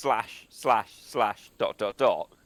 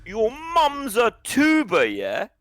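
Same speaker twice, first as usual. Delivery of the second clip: mock taunt; 9 semitones higher, 4 dB louder, slower than usual. Catchphrase aimed at potatoes.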